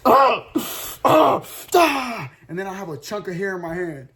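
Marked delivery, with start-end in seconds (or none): 0.1-2.3 frustrated yells. Acted. Frustrated over word problems, pulling hair.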